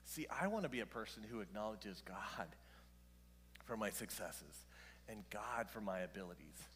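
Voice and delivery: whispered, intimate confession; a hushed aside to the congregation. Real, not acted. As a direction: lean in and whisper it.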